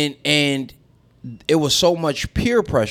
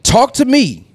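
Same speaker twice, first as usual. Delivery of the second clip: high mock pleading voice; mock plea; 11 semitones higher, 5 dB louder, slower than usual. Mother ignored by church staff, mimicked high and pleading. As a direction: squeak it out like a hurt plea.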